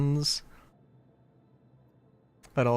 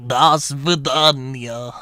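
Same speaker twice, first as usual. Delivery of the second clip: slow, louder, hammed-up Russian accent; mock Russian accent; 4 dB louder, slower than usual. Streamer signing off a bit, mock Russian accent. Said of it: "It's not Russian Italian".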